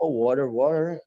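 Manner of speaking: WATER as "woder", flapped t, questioning; American pronunciation. Shown as a demonstration. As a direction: say "water" twice as "worer", as if puzzled. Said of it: "yang baru basic mungkin bingung". ("water" ala Amerika, "woder", nada bingung)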